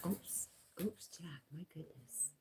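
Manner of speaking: hushed oops under the breath; whisper. Real, not acted. Owner whispering to her dog Jack after a slip.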